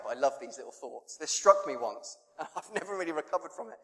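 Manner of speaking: usual speaking voice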